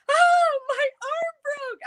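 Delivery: high-pitched, loud wail of pain; retold yelling. Telling husband the arm just broke, retold yelling. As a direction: cry out in a re-enacted panic. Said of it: "I was yelling".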